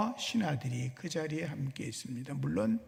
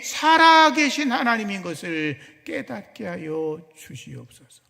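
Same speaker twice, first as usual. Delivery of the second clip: solemn invocation swelling to a loud, high plea, then dropping at the close; fervent prayer; 4 semitones higher, 10 dB louder than usual. Acted. Elijah praying at the altar for God to answer, fervent plea. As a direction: cry out each petition with full force. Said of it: "하나님께 기도합니다".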